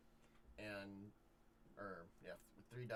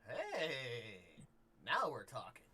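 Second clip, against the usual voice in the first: delighted drinker; 2 semitones higher, 7 dB louder, much slower than usual. Acted. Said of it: "She kinda looks at you and goes".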